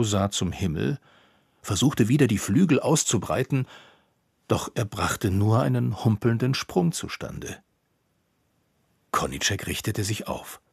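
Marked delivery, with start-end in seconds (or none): none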